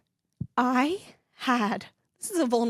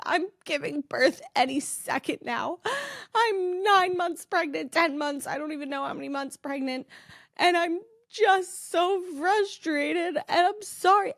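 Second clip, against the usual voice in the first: upset, near tears; 6 semitones higher than usual. Retold or imitated. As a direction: sound like you are about to cry on the phone. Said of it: "I could not even get the words out".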